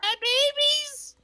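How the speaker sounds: very high, slow squealed lament; hammed-up squeaky wail of loss; mock-grieving wail. Mourning the killed dogs.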